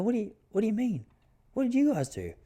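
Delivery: put-on clueless kid who has no idea what happened; baffled confusion. Confused reply to friend's question.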